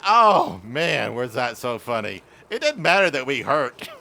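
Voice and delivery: plain reading voice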